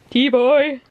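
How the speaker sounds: loud, high, lofty summons; imperious plummy grande dame. Pretend. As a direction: call for your servant grandly. Ordering partner to bring tea.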